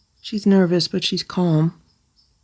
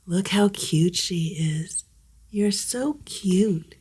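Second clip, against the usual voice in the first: ordinary delivery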